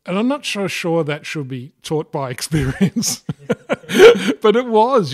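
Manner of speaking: chuckling at the absurdity of it; laughing. Real, not acted. Pilot laughing to the host about his course, laughing.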